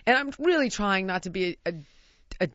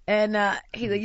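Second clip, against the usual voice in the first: usual speaking voice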